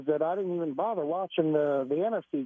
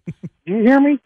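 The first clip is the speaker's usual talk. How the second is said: laughing excitedly; 5 semitones higher, 10 dB louder than usual. Real, not acted.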